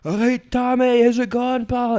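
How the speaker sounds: high, loud Glaswegian call; junkie neighbour. Retold or imitated. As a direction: call out like a rough old pal. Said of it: "a junkie friend that would come see him and go".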